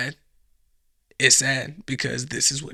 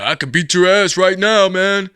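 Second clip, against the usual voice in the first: put-on loud, higher drunk challenge; mock drunk guy; 5 semitones higher, 10 dB louder than usual. Drunk guy challenging a UFC fighter, loud and higher. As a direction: swagger like a drunk. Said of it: "is like".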